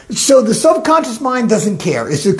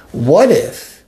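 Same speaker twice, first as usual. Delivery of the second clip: normal talking voice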